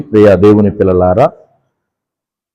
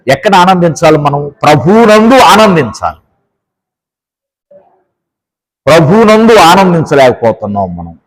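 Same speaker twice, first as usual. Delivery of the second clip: fervent preaching; 6 semitones higher than usual. Real, not acted. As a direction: drive the point home with fervor. Preaching with rising force.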